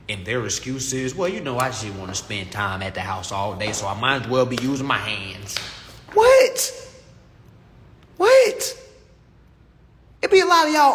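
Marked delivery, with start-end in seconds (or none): 1.2-5.6 low, mocking old-timer voice; lightly voiced retiree making excuses; a touch of a tired old man; imitating an old head. 6.1-6.9 high, loud disbelieving what; loud outraged what; incredulous what. Real, not acted. Incredulous reaction. 8.2-8.8 high, loud disbelieving what; loud outraged what; incredulous what. Real, not acted. Incredulous reaction repeated.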